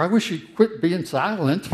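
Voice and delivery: frustrated appeal to a silent God; psalmist's complaint. Retold or imitated. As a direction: press God to speak. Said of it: "the psalmists who say".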